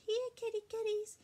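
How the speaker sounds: high singsong call; calling the cats. A narrator's reading. Cassie calls kittens to their food, singsong calling. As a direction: coax the kittens over in a high voice. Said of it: "and called".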